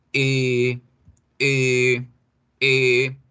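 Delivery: drawn-out E with lips rounded for O; long rounded E. Shown as a demonstration. Teacher shows the mouth shape, long rounded E. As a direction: hold E like two or three in a row. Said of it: "redondee la boca como si fuera a decir la O".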